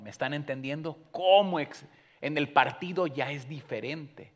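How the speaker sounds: loud, high, fervent preaching to the congregation; preaching at full force. Acted. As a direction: drive the message home with full-throated fervour.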